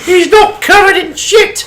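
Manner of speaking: very high falsetto Python crone; comic high-pitched old lady; Monty Python old lady. Retold or imitated. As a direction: quote it in a squawking mock old-woman voice. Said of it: "turn this into nothing but quoting, like, Monty Python".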